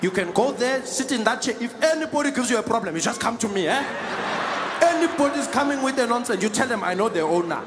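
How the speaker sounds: lively South African passenger, pleased with himself; easygoing local uncle talking down a stranger; hint of a South African accent.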